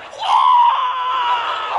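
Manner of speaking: shrieking high burst of laughter; wild laugh. Real, not acted. Preacher recalling the punch, high shrieking laugh. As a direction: let a huge squealing laugh fly.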